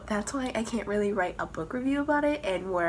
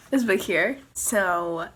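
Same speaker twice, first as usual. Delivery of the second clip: ordinary talk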